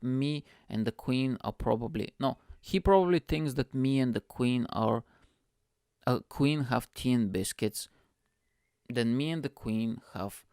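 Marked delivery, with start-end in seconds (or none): none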